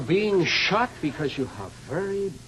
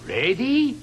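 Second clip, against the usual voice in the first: high, loud firing squad call; loud military countdown; shouted command; 7 semitones higher, 6 dB louder, much slower than usual. Acted. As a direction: bark out ready and aim.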